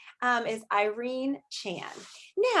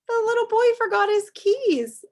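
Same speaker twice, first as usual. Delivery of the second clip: high and loud, thrilled; a teacher dramatizing the picture; excited; 11 semitones higher, 6 dB louder than usual. Real, not acted.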